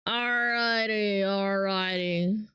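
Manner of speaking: slow, stretched-out filler; slow sing-song murmur to oneself; idle drawl. Real, not acted. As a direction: draw out each word lazily. Idle filler while busy.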